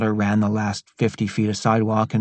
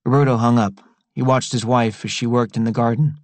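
plain reading voice

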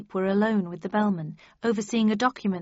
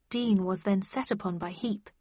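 ordinary delivery, nothing marked

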